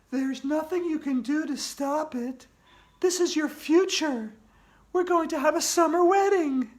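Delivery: put-on eerie ghost girl gloating.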